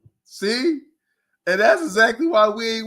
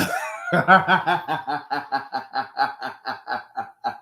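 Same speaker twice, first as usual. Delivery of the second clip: long rolling laugh; 4 semitones lower than usual. Real, not acted. Laughing at the player to viewers, long laugh.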